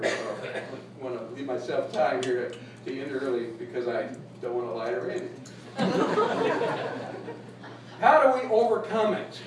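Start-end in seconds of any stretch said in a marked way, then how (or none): 5.3-8.0 high, breathy giggle; light high chuckle; high laugh. Real, not acted. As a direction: laugh at your own joke. Laughs at Randy joke.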